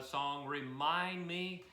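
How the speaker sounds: ordinary talk, nothing marked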